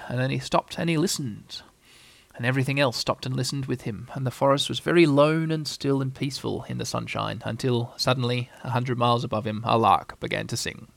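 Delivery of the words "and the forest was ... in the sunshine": ordinary delivery, nothing marked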